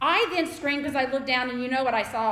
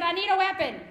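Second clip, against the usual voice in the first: higher, louder urgent demand; urgent son; 7 semitones higher, 6 dB louder than usual. Retold or imitated. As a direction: plead for a weapon.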